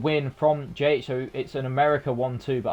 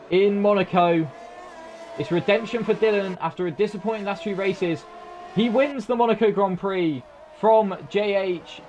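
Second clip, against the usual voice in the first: raised pitch, driving race-finish call; live race commentary; 6 semitones higher than usual. Real, not acted. Commentator calls the Monaco winner to viewers, excited race call.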